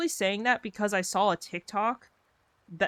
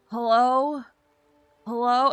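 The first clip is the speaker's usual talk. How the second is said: higher, louder, flustered hello called twice; nervous hello; 6 semitones higher, 6 dB louder, slower than usual. Retold or imitated. Nervous job applicant answering the interviewer, flustered and higher. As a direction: play the panicked nervous interviewee calling hello. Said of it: "I would always get so nervous".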